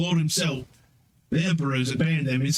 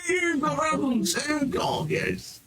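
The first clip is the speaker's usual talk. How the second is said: high-pitched theatrical wail; mock dismay; much slower than usual. Game master reacts to the captain's ribbons, high mock wailing. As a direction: cry out much higher, drawn out and hammed-up.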